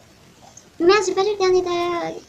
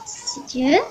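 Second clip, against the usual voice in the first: normal talking voice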